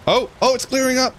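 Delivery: high, bright burst of relief; suddenly thrilled, voice jumping up; excited. Real, not acted. Fog lifting suddenly.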